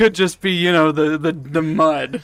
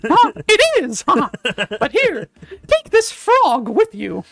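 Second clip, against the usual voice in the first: squeaky witch voice; 12 semitones higher than usual. Acted. Witch voice very high.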